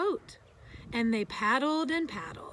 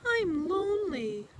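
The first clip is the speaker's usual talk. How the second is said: distant echo voice; 7 semitones higher, 3 dB louder than usual. A narrator's reading. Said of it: "said the voice from across the pond".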